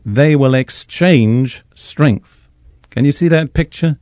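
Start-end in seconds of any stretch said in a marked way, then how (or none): 0.0-2.5 very slow, each word set apart; literal rendering. Shown as a demonstration. Preacher giving the Hebrew meaning, very slow and deliberate. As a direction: lay out the words one by one. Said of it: "The Hebrew says literally".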